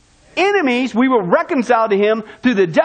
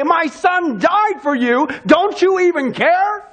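higher, slow, heartbroken cry; anguished outcry; 6 semitones higher, slower than usual. Retold or imitated. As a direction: plead with them like a grieving parent. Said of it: "jump up and scream and say".

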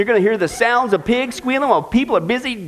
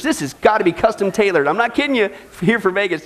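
usual speaking voice

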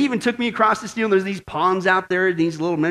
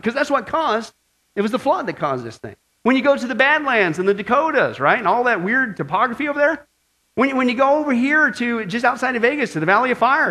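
usual speaking voice

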